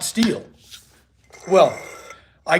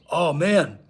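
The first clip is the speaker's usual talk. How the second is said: ordinary delivery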